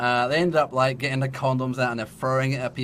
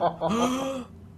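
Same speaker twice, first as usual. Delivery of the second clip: big acted gasp of shock; audible gasp; 13 semitones higher than usual. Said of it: "this audible gasp".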